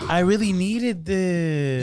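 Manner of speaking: slow, drawn-out mock thanks; hammed-up grateful reaction, stretching every word; fake gratitude. Joking about faked gift reactions, fake gratitude. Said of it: "everyone's just so fake on their reactions".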